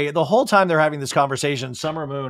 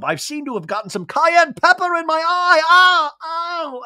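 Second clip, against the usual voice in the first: much higher hammed-up whine; mock pain; 13 semitones higher, 5 dB louder than usual. James with pepper in his eye, in a high hammed-up whine. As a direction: overplay the sting in his eye. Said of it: "she's like".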